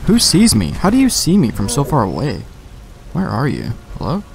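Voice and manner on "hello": ordinary talk, nothing marked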